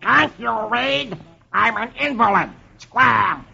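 high, slow cartoon duck yell; Donald Duck. Donald to the salesman at his door, yelling duck voice. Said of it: "and he yells".

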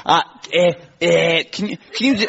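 faltering stab at Scouse; attempted Scouse accent. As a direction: feel your way into a Scouse accent. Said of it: "that's how you do a Scouse accent".

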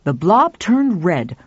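usual speaking voice

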